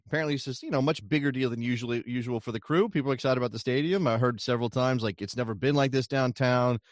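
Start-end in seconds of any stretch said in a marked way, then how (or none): none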